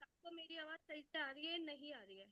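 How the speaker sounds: very faint, fading mic; almost inaudible voice; barely audible. Real, not acted. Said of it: "It's not audible".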